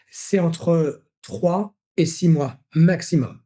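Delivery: very slow, each word weighed; slow emphasis. Shown as a demonstration. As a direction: spell out the ideal rhythm slowly. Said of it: "insiste sur le rythme idéal". (lentement, en appuyant chaque mot)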